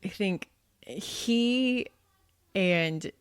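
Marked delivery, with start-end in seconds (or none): none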